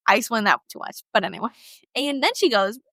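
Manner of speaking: ordinary delivery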